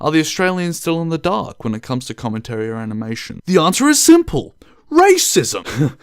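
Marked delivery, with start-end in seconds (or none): 3.5-4.6 dramatic reveal. Acted. Dramatic buildup to punchline. 4.9-6.0 hammed-up punchline, loud and rising; mock dramatic reveal. Vlogger jokingly blurts a fake answer, hammed-up. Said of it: "No, we aren't gonna suit that low".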